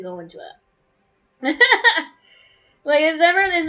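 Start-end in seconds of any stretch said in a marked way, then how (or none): 1.6-2.1 loud high laugh; a sudden amused cackle; high burst of laughter. Real, not acted. Laughs at own joke.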